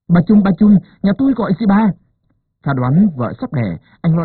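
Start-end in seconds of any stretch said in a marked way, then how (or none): 0.1-1.9 loud, higher flustered call. A narrator's reading. Husband frantically waking the midwife, loud flustered call. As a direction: call out in a panic. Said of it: "cuống quýt nhòm dậy gọi".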